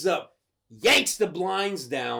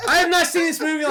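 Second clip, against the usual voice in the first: fast, high, loud and laughing; giddy insistence; real excited boast; 10 semitones higher, 6 dB louder, much faster than usual. Real, not acted. Telling a friend how well he remembers the movie, giddy boast.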